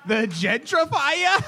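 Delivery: hyped announcer shout. Acted. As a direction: hype it like a wrestling host. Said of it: "too much of a Boston accent".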